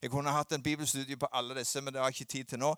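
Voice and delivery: usual speaking voice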